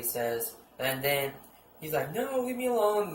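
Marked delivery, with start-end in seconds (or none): 2.1-3.1 high-pitched, hammed-up plea; mock panic. Moxon fleeing Batman, in mock panic. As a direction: plead in a high mock-frightened voice.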